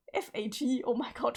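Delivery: ordinary talk, nothing marked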